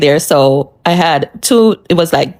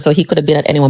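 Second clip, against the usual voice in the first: ordinary talk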